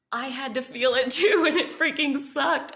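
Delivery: high, loud mock outburst; exasperated protest. Child protesting to grandma about shared pain, exasperated.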